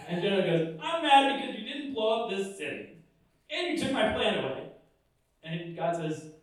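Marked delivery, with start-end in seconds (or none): 0.8-4.7 high petulant complaint, half joking; high-pitched, petulant mock rage; whiny mock anger. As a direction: sulk like a spoiled kid. Jonah answers why so mad.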